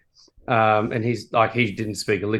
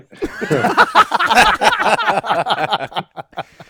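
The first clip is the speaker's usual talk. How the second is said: long, high burst of laughter; big laugh; 7 dB louder than usual. Real, not acted. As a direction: crack up hard at your own joke. Cracks up at own joke.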